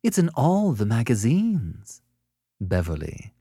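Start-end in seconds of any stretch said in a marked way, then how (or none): 0.0-2.0 high, slow, sugared tone; sweetly. A narrator's reading. Annette sets up her jab at Sellers, sweetly. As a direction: draw it out with false sweetness. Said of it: "she went on sweetly".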